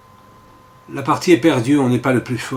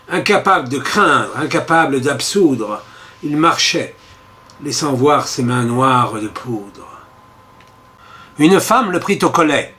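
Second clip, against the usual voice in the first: ordinary delivery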